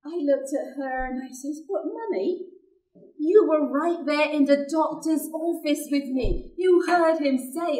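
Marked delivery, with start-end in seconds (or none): 1.7-7.8 put-on higher, louder indignant child voice; lightly voiced daughter protesting to Mummy; protesting little girl. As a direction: plead like a wronged child.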